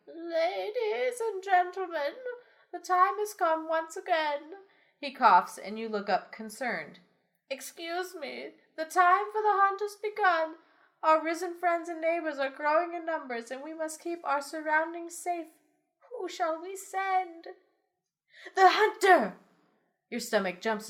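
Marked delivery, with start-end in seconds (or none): 0.1-4.4 put-on high, slow old elder voice; town elder voice. 7.5-17.5 high ceremonial elder voice; town elder voice. Acted. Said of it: "The elder addresses the town". 18.6-19.4 crowd shout. Acted. Crowd proclaims champion.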